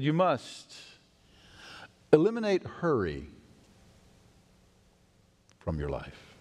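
measured words with long pauses; deliberate advice. Retold or imitated. Older pastor advising a young pastor, deliberate.

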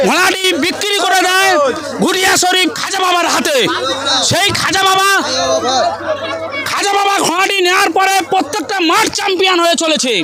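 ordinary delivery

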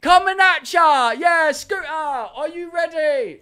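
high, loud English hype-man yelling. Acted. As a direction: roar it at a rave crowd. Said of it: "It's just an English guy yelling".

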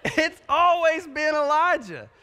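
high, slow words broken by laughter; laughing. Real, not acted. Dad laughing to the crowd about his son's new name, slow and high. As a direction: laugh it out in disbelief. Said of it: "He's never been Eli".

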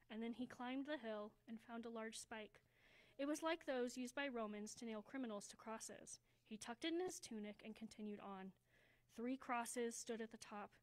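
plain reading voice

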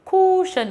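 "cushion" said loudly as "koo-shun", long oo; mispronounced cushion. Shown as a demonstration. Teacher imitating learners' mistake, "cushion" as loud "koo-shun". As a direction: say "cushion" wrongly with a drawn, rounded oo. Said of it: "có người đọc là cushion này".

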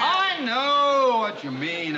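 loud, high, drawn-out protest; indignant retort. Acted. Banker answering secretary's hot air jibe, indignant. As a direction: cut off the excuse, stretching the words.

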